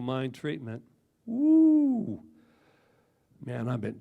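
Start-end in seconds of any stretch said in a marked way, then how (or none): 1.3-2.2 high, loud "woo"; sudden loud, soaring cheer; loud whoop. Real, not acted. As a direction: let out a high-pitched whoop. Whoops after naming the treatment.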